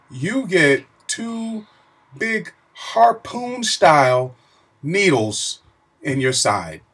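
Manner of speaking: slow, high, drawn out; dramatic emphasis. Real, not acted. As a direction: stretch each word for effect. Stressing the big needles.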